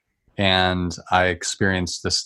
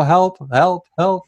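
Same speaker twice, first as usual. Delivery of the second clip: higher, pleading cry of help, help, help; desperate cry for help; 9 semitones higher, 4 dB louder, slower than usual. Retold or imitated. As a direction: beg for help from a dark place. Retold despairing prayer in closet.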